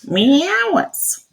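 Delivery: Nottingham accent. Shown as a demonstration. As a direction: sing out "Me hours" high, Nottingham-style. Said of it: "that's how you would say it in Nottingham".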